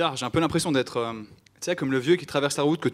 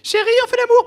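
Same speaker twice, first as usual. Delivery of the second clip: put-on girlfriend voice; 11 dB louder than usual.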